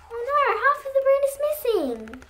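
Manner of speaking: quick, high, hushed aside; worried aside to children. Acted. Reader frets aloud to the children, quick and high. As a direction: rush it out, high and worried for the kids.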